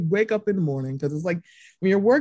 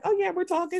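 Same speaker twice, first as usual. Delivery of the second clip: casual friend voice; 10 semitones higher, 4 dB quieter than usual. Retold or imitated. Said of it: "She was like".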